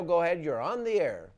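usual speaking voice

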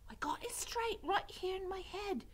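put-on high, hushed serving-girl voice; whispering. A narrator's reading. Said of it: "whispered Mig".

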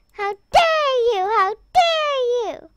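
high, loud outrage with long DARE; playfully outraged cry; indignant scolding. Acted. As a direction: scold them loudly, affronted. Scolds whoever shot the player.

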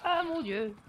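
high, loud ah mon Dieu; real startled exclamation; shocked cry. Real, not acted. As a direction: yelp in surprise. Shocked by shot.

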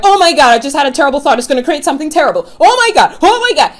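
frantic, fast, high, loud freak-out; panicked. Retold or imitated. Imitating anxious people panicking over a bad thought. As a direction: gasp it out in a rushing panic. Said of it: "they get all this anxiety".

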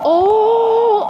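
high, loud drawn-out "oh"; exaggerated gasp of false hope; mock excited discovery. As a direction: let out a big hopeful cry. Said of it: "you're like".

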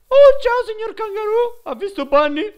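high, loud sing-song greeting; mock sweet greeting. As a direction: greet the kangaroo like a cartoon host. Greeting game animal in put-on voice.

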